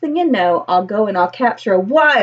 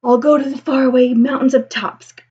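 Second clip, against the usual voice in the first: ordinary delivery, nothing marked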